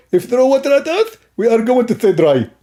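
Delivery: high, lisping put-on voice; comic opponent. Opponent pitching waterproofing to the host, high lisping voice. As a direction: rise up high and silly, with a lisp. Acted opponent explains product.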